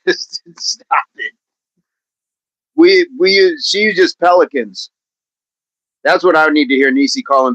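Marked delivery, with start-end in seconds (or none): 0.1-1.3 soft laughing stop it; laughing, quieter; laughing protest. Real, not acted. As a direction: giggle it out softly. Laughing reply.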